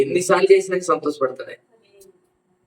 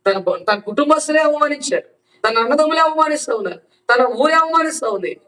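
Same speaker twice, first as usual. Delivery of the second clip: fervent preaching; 8 semitones higher than usual. Acted. Pastor urging the congregation about their enemies, impassioned preaching. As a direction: preach it out hard, pitched high.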